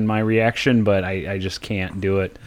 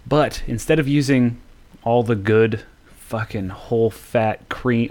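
ordinary talk

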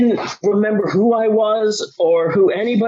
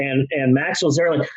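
normal talking voice

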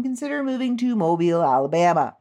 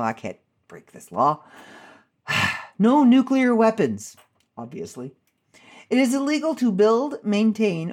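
ordinary talk, nothing marked

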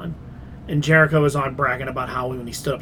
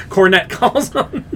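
laughing; 4 semitones higher, 3 dB louder, slower than usual. Real, not acted. Laughs naming wrestler.

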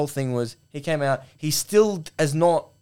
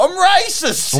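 high, loud mock admission; mock confession; 8 dB louder, much slower than usual.

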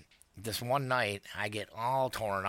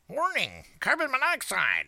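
high, loud machine warning voice; mock robot alarm announcement; robotic alarm voice; 6 dB louder than usual. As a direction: mimic an automated carbon monoxide alarm. Imitating alarm voice.

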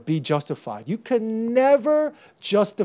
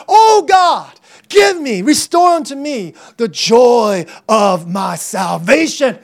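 shouted plea, opening high; crying out to God; 3 semitones higher, 8 dB louder, slower than usual. Acted. Preacher as David begging God for joy, shouted plea. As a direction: plead at full voice, stretching the words.

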